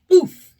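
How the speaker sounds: short loud grunt of impact; blurted oof. Acted. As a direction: grunt on bumping a wall. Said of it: "Pinocchio blurted out".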